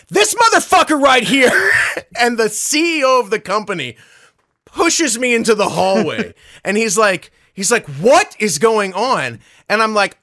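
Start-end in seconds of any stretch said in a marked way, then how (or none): 0.1-1.5 high, very loud public call-out; retold outraged bellow; acted furious shout. Acted calling out man publicly. 7.9-9.4 slow, raised-voice confrontation; retold exasperated question; acted stern demand. CEO's acted demand in argument.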